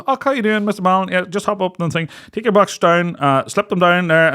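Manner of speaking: lightly voiced cheery nurse; imitating the nurse. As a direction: play the chirpy nurse putting him at ease. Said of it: "She goes".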